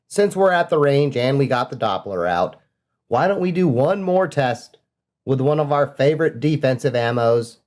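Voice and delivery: ordinary talk, nothing marked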